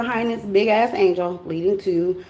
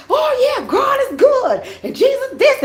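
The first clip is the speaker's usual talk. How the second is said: high, shouted churchy praise; mock happy believer; 14 semitones higher, 3 dB louder than usual. Mocking people who praise God when things go well, high shouted joy. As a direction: ham up an overjoyed churchgoer.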